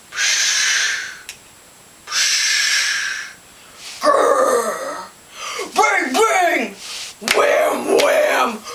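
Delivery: hushes, a growl, bangs and whams; hissing, growling, then punchy ringing bangs; imitated monster noises. A narrator's reading. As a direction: act out a string of scary sounds. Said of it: "It goes".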